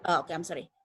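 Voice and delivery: normal talking voice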